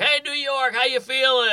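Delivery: high-pitched mock stage greeting; hyping the crowd. Hyping a crowd onstage, retold.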